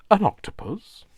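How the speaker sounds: high, loud, puzzled "An octopus?"; quizzical surprise. Retold or imitated. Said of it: "in a very quizzical kind of, like, surprised sort of way".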